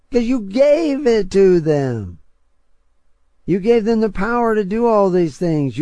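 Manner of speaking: rising sermon shout, slowing on the key words; fiery preaching. Acted. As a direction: preach it like a warning from the pulpit. Preaching at full force.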